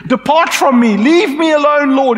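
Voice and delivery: high, loud, pleading cry; a broken man begging to be left; desperate Peter. Acted. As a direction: Peter on his knees cries out in shame. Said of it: "Peter falls on his knees and says".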